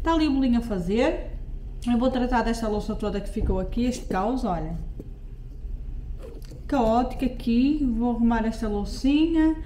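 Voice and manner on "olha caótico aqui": normal talking voice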